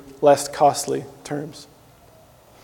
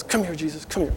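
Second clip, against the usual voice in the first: hushed coaxing; 11 dB quieter than usual. Retold or imitated. Disciple quietly beckoning Jesus to step aside, hushed and sly. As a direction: coax him over quietly, like a schemer.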